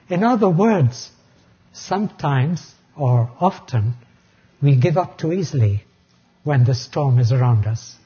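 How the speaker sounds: ordinary talk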